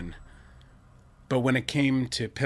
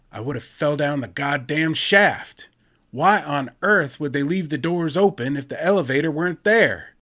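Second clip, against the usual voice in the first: ranting; 5 semitones higher, 8 dB louder than usual. Acted. Torrance rants about open shaft.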